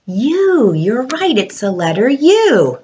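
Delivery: usual speaking voice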